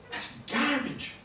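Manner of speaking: high, drawn-out mock Scottish heckle; slow, high-pitched jeer in a put-on voice; Mel Gibson on steroids. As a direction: imitate an over-pumped action-hero heckler. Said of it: "Mel Gibson on steroids or something".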